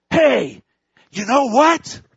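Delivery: high, loud parrot squawk; cheeky parrot. Acted. Parrot taunting the shopping couple, high loud squawk.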